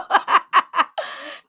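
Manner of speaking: a bright burst of laughter; high giggle. Real, not acted. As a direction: laugh at your own outburst. Laughing at her own aside.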